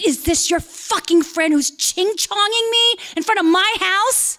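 high-pitched outraged demand; angry yell. Acted. Angry resident yelling at her neighbor on the stairs, acted outrage. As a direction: pitch it way up in indignation. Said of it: "And I yelled at her".

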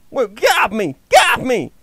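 ordinary talk, nothing marked

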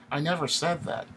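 high-pitched, nervous denial. A narrator's reading. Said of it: "Eric gets nervous".

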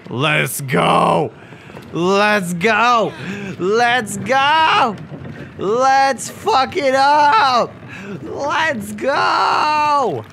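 high, drawn-out victory yells; triumphant shouting. Real, not acted. Player celebrating the found wheel, triumphant shouting.

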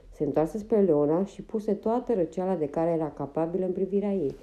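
usual speaking voice

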